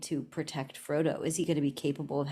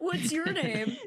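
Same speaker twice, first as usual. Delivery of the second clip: goofy spider husband; 10 semitones higher, slower than usual. Pretend. Helob voice.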